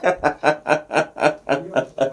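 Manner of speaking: real chuckling run of laughter; hearty laugh. Real, not acted. Host teasing a guest about her Pidgin, hearty laugh. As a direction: laugh in a long, even string of ha-has.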